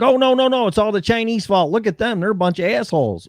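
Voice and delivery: mocking blame-shifter. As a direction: mimic a smug politician passing the blame. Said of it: "So it's like".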